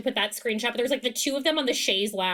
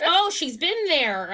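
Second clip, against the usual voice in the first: surprised realization; 6 semitones higher, slower than usual. Retold or imitated. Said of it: "And we're like".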